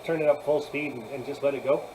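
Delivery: ordinary talk, nothing marked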